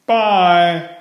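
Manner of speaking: put-on a child calling goodbye. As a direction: call bye on the way out the door. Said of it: "called Franklin".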